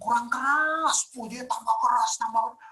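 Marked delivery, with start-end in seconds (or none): 0.0-1.1 louder, higher, slow mocking jeer; teasing taunt. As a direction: taunt the prophets to shout louder. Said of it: "Si Elianya ngeledek".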